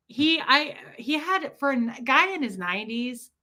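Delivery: usual speaking voice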